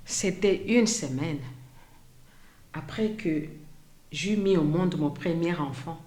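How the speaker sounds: softly spoken survivor's testimony, long pauses between phrases; témoignage déclamé. Acted. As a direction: recount it quietly as the woman who lived it. (témoignage dit à voix basse, avec retenue)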